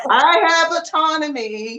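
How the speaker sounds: put-on high-pitched little girl declaring her rights; imitating young daughter. Mother voicing her daughter at family functions, high and assertive. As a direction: pitch it up high, a child standing firm. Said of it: "she'd be like".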